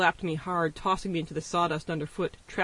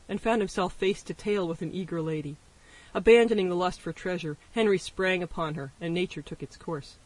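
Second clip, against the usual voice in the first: usual speaking voice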